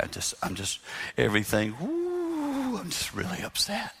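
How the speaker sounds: hushed, strained quiet with a squeaky high woo; bottled-up frustration kept soft; mock soft upset. Husband insisting to wife he's upset, mock hushed. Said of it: "lower it to half your normal volume".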